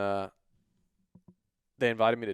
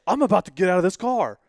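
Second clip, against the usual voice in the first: yelling, much higher and much louder; furious shout; 10 semitones higher, 13 dB louder than usual. Retold or imitated. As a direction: blow up at being told to calm down. Mom threatening, retold.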